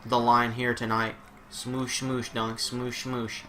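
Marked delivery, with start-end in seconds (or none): 1.5-3.4 soft, mushy cooing. Real, not acted. Owner cuddling pet mid-match, soft baby talk.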